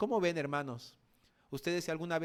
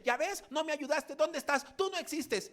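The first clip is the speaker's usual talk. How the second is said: much higher, loud bitter accusation; bitter believer; 13 semitones higher, 3 dB louder than usual. Retold or imitated. Fallen believer telling God he does not exist, high bitter whine. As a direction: spit it at God in disappointment. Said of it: "Y luego que dice".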